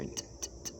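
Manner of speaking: unvoiced t t t counting rests; soft ticking t sounds marking the gap; whispered beat taps. Shown as a demonstration. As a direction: tap out the empty syllables in a whisper. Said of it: "three syllables right there of space".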